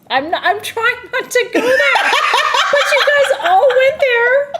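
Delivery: high, loud, laughing helplessly; trying to talk while losing it to laughter; real giggle fit. Real, not acted. Host cracking up live at the fish story, laughing. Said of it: "I'm trying to shut the fuck up".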